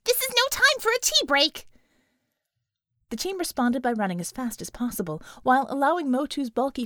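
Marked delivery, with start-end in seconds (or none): 0.0-1.7 high, loud British yell; shouting. Acted. Bella yelling at the team to move, high and loud. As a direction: bark at them to hurry. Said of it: "Bella shouted".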